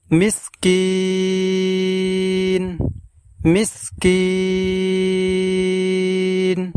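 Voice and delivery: মিসকিন with the last কিন drawn out slowly; slow recitation drawl on মিসকিন; long final vowel. Shown as a demonstration. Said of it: "এখানে আমরা শেষে টান দেব কিন".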